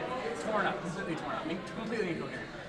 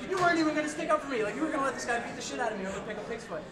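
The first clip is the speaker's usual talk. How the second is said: fast, higher, incredulous; 6 semitones higher, faster than usual. Retold or imitated. Teller retelling his outrage at Aaron, fast and incredulous. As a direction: rattle it off in disbelief. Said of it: "I'm like, incredulous".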